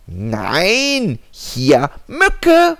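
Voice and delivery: high, loud squeaky mosquito; tiny mosquito voice. Acted. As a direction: chirp it like a tiny insect. Mosquito answers.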